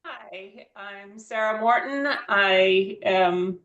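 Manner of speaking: ordinary talk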